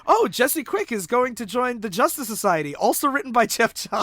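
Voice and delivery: high, loud hammed-up plug; mock-enthusiastic announcer recap; mock promo. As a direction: gush it like a shameless advert. Mocks recap as advertisement for comics.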